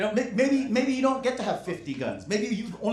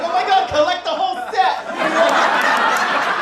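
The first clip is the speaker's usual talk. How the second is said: much higher, louder, slow, laughing gush; mock dazzled public; 12 semitones higher, 10 dB louder, much slower than usual. The public gushing over new coins, in a high, loud, slow, laughing squeal. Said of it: "And we're like".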